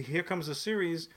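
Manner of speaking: ordinary talk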